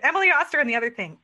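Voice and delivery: rushed, loud, high blurt; excited blurt. Retold or imitated. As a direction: spit the reminder out in a hurry. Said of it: "I was like".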